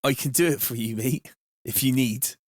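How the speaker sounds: light Bristolian accent, played for the chat. Shown as a demonstration. Streamer obliges a viewer asking for his accent, Bristolian accent. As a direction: put on a Bristolian accent briefly. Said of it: "Where is your Bristolian accent".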